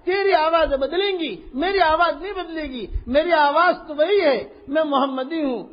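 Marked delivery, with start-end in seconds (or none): none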